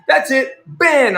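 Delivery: high, loud curt verdict; mock angry seller. Seller banning the buyer, a high loud mock snap. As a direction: slam the ban down. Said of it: "And he said".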